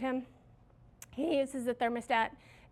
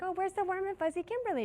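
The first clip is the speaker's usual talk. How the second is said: higher, teasing voice of a puzzled employee; mock staff question; 8 semitones higher than usual. Staff teasing the grumpy manager, in a higher mock voice. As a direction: play the staff wondering where the friendly boss went.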